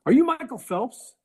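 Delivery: high, loud excited fan voice; Dunkin guy. Retold or imitated. Dunkin Donuts guy asks Eli if he's Michael Phelps, high and loud. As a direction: pitch it way up as the eager coffee-shop guy.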